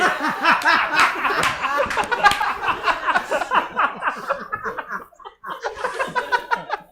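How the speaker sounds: big high shrieking laugh; loud burst of laughter. Real, not acted. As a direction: lose it completely laughing. Huge laugh after Snoopy line.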